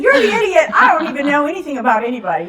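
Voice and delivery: high, loud, fast comeback; retold shouting match with a friend; indignant shout. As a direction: throw the insult right back. Firing back at her friend.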